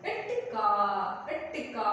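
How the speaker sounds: ఇట్ cut short, then a long కా; clipped pollu consonant. Shown as a demonstration. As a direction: stop on the T of ఇట్ కా before the vowel. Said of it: "పొల్లుగా పలకాలి". ("ఇట్ కా": ట్ పొల్లుగా, కా సాగదీసి)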